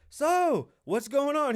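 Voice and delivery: high, loud talk-show host opener; Oprah. Acted. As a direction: imitate an interviewer kicking off brightly. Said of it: "'Cause Oprah was like".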